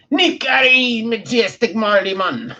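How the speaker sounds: mock Jamaican accent. Shown as a demonstration. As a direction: announce it Jamaican style. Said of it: "[name] Mon, repeated take".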